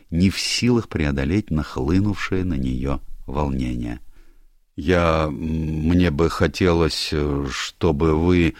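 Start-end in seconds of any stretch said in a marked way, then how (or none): 4.8-8.5 slow, halting, fumbling start; slowly hesitating, lost for words; flustered. Acted. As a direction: stammer it out, embarrassed. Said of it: "начал было смутившийся Гастингс".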